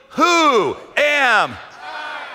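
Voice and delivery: Moses' question dragged out slowly. Acted. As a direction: stretch Who am I out word by word. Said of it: "Moses says to God slowly".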